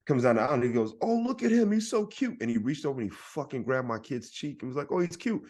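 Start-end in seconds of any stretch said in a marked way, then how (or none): 1.0-2.4 high-pitched mock cooing over a child. Old guy on a plane admiring a kid, mock cooing. 4.9-5.4 high-pitched mock cooing over a child. Old guy on a plane admiring a kid, mock cooing.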